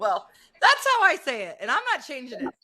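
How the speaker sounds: high, loud mock stubbornness; I'm keeping it, stubborn; playful defiance. Real, not acted. Playful defiance over pronunciation.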